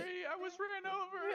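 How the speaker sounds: whiny wail. Pretend. Whining victim complaint.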